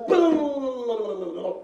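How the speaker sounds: loud high rolled brrr; cart driver's "brrr". Acted. Magistrate drives the cart, loud rolled brrr. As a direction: roll the lips loudly at horses.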